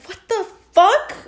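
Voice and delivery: high-pitched incredulous outburst; appalled disbelief. Retold or imitated. Friend reacting to the creepy tidal wave, incredulous. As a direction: act out baffled disbelief, pitch leaping up.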